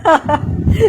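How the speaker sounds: quick amused laugh; a brief rising laugh; a light laugh at the comment; short laugh. Real, not acted. Laughs after reading the comment.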